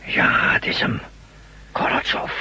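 hushed whisper naming him. Acted. Friend whispering back to confirm the wounded man is Gorotjov. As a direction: whisper the name warily.

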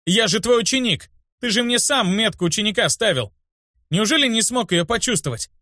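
высокий, возмущённый голос; выскажи учителю всю обиду, не срываясь на ор; вспышка возмущения. A narrator's reading. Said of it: "После этого взорвался уже я". (возмущённая тирада ученика)

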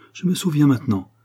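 high-pitched exclamation of recall; exclaimed. A narrator's reading. Patient remembering his attacker, exclaimed. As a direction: call it out as it comes back. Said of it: "s'écria Zampa".

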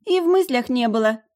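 ordinary delivery, nothing marked

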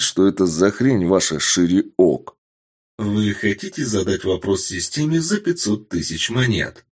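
ordinary delivery

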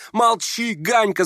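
put-on high boyish cry; shouting. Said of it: "закричал Коля". (высокий крик мальчишки)

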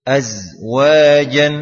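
Azwajan, slow with a loud buzzing z. Shown as a demonstration. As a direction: draw out the z like a bee. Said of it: "Kita baca contohnya". (dengung z panjang dan keras)

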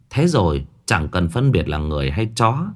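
usual speaking voice

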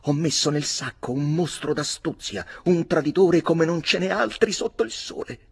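grida acute e più forti; sfuriata furiosa. A narrator's reading. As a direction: urla il disprezzo con tutta la voce. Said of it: "continuava la sua sfuriata con grida sconnesse". (milady urla al marito, acuta e furibonda)

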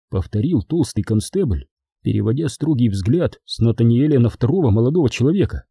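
plain reading voice

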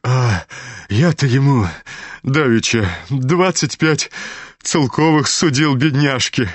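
high, halting amazed exclamation; stunned amazement. A narrator's reading. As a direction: exclaim it, dumbfounded. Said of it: "Почти ошеломлённый от изумления проговорил генерал".

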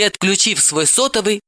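ordinary delivery, nothing marked

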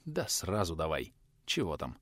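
tired, low and soft; drained, giving up; weary. A narrator's reading. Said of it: "Устало отвечал преподаватель".

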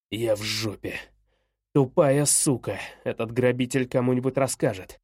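low-voiced, contained anger; angry father. A narrator's reading. Father realising he will be exposed, low and angry. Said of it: "никогда не видел его таким злым".

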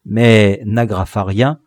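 ordinary delivery

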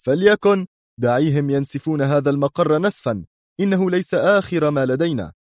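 high, stern cutting-in command. Acted. Mr X sternly interrupts Sonia and orders her down, high. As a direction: cut her off and take command. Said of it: "قاطعها في صرامة".

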